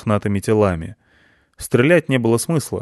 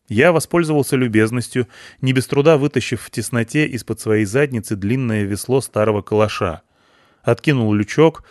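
usual speaking voice

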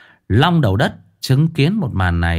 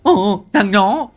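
howling thug; 5 dB louder than usual. A narrator's reading. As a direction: wail it out through a busted mouth. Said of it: "Nó rú lên".

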